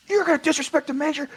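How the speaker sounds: very high mock-outraged major; offended major. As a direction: squeal with wounded rank.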